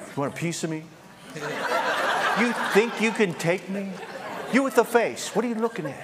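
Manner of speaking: high-pitched, hammed-up picking a fight; mock tough challenge. Preacher squaring up to a demon, mock tough challenge. Acting out a confrontational, rough challenge.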